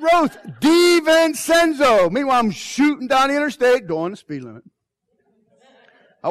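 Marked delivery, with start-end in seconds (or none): 0.0-2.1 raised and slowed, laughing through the name; slow loud name spelling. Retold or imitated. Husband repeating wife's name on the phone, loud, slow, laughing.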